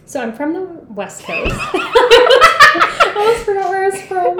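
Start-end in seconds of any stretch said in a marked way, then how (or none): 1.2-4.4 laughing. Real, not acted. Laughing through nervous answer.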